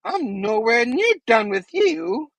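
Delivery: high, slow, taunting villain voice; Green Goblin voice. Retold or imitated. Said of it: "the Green Goblin's like".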